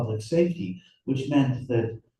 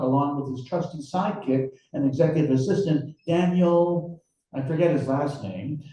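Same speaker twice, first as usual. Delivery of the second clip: reading his novel aloud, a little higher; story narration; 5 semitones higher than usual. A narrator's reading. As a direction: narrate the scene for listeners.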